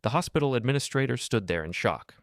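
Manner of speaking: plain reading voice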